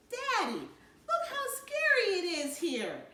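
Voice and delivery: put-on high, slow frightened child; small voice, pleading and slow; scared little girl. Little Joey pointing at the dark hills, scared.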